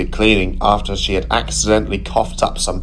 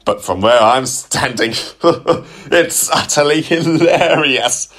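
laughing sneer; 6 semitones higher than usual. Acted. Philip jeering at Gladys, high laughing gloat. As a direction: gloat through giggles.

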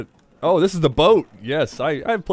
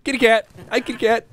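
high cutesy pet talk; 8 semitones higher than usual. Real, not acted. Greeting the pet cat character.

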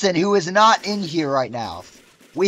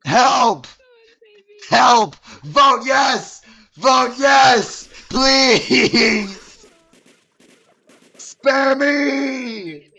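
high, shouted pleading victim; being chased; 5 semitones higher, much slower than usual. Pretend. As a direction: beg for mercy while fleeing.

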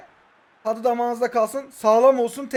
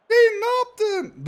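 very high, drawn-out loud wail; dismayed yell; 14 semitones higher, 5 dB louder, slower than usual. Real, not acted. Player yells at Hüseyin over a mistake, dismayed. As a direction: cry out in disbelief at the blunder. Cries out after a blunder.

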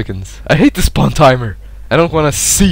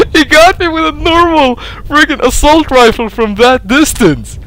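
high-pitched squealed complaint; incredulous protest; 12 semitones higher, 3 dB louder than usual. Real, not acted. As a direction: whine high in disbelief. Complains about being killed.

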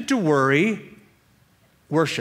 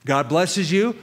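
ordinary delivery

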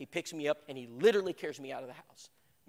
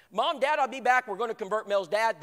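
louder and higher, full of zeal; eager excitement; 5 semitones higher, 9 dB louder than usual. Retold or imitated. Teen telling his parents he'll convert a dad, eagerly. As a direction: promise it'll be quick, confident and thrilled.

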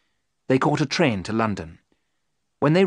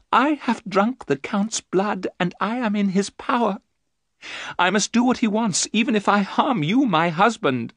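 put-on high, despairing young wife; 10 semitones higher than usual. Mina confesses.

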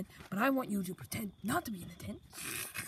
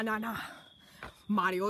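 normal talking voice

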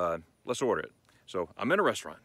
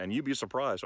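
usual speaking voice

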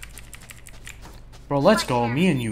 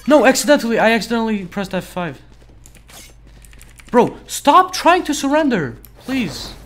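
loud, high, frantic begging; urgent pleading; 4 semitones higher, 7 dB louder than usual. Real, not acted. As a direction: plead loudly with the team. Pleading team not to surrender.

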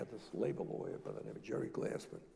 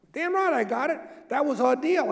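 loud and much higher, hammering the point; loud, high mock-indignant insistence; forceful insistence; 12 semitones higher, 13 dB louder than usual. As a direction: bark back like a man holding firm to his deal. Ross insisting on his deal.

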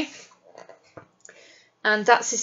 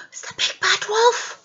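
gasping high shocked reveal; 8 semitones higher than usual. Acted. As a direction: gasp then reveal it.

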